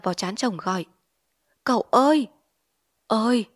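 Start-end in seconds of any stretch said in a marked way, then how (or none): 1.6-3.5 higher, louder scared cries; frightened calling. Acted. Frightened wife calling her husband out of delirium. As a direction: call him back to his senses, afraid. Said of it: "Chị San sợ hãi ... gọi".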